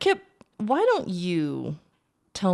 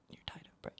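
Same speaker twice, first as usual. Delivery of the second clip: menacing whisper; 23 dB quieter, faster than usual. Acted. Villain leans in to captive.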